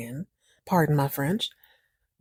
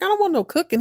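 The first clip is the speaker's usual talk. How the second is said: high-pitched mock protest; mock pissed; 12 semitones higher, faster than usual. Pissed husband turning down dinner, mock pissed. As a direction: refuse in a raised, put-out voice. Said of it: "He's pissed. He's like".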